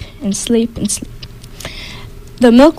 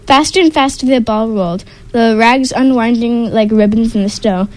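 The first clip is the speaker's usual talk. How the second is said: ordinary delivery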